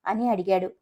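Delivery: usual speaking voice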